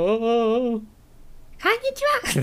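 put-on high cute anime girl voice; squeaky cute anime girl greeting; moe voice. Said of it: "[name]さん、萌え声やってよ".

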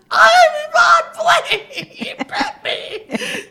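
very slow, high, crying-laughing wail; tearful protest. Retold or imitated. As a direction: drag out a tearful denial. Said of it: "And I'm like".

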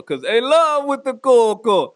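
slow put-on silly voice; mock drug-slurred voice. Joking about cocaine in Coke, slow goofy voice. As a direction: stretch it out like a goofy character.

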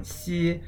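Xi held high and level; Xi in first tone. Shown as a demonstration. Host spelling the word: Xi on a high flat tone. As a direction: pitch Xi high and steady. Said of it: "X I in the first tone".

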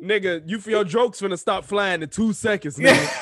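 loud, high mock threat; loud warning. Real, not acted. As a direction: bark a playful threat. Threatening to mute in argument.

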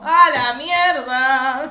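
loud, very slow drawn-out exclamation; mock stunned outburst; exclaiming. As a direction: blurt it out overwhelmed. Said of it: "Yo también estaría re como".

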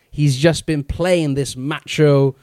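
usual speaking voice

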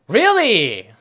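loud, high shocked "really". Real, not acted. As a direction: blurt it out in disbelief. Shocked reaction to news.

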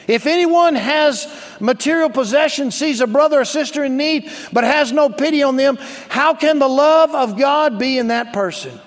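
high, loud preacher's proclamation; full-force scripture reading. Acted. Pastor reading First Peter to the church, loud preaching. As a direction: drive each command home loudly.

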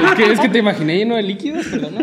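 laughing confession. Real, not acted. Admitting to a friend she pictured him, laughing. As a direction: giggle out the embarrassing thought.

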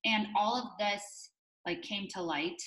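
ordinary talk, nothing marked

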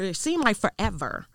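ordinary delivery

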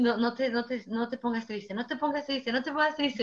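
hushed self-comfort, repeated; soft retold pep talk to oneself; self-soothing murmur. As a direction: quietly talk yourself out of sadness. Consoling herself on arrival, alone.

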